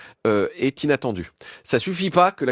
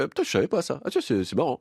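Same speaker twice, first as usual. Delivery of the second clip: amazed exclamation; 3 semitones higher than usual. Retold or imitated. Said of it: "vous ferez".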